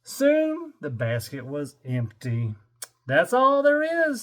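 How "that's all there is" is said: higher, louder zookeeper call. Acted. Mister Johnson telling the seals the fish is gone. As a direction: call it out like the keeper. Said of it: "said Mister Johnson".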